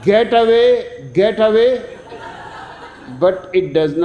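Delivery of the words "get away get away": louder, higher storyteller's shout; raised-voice warning in a told joke; narrated shout. A narrator's reading. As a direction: call out to drive them away. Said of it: "he shouts".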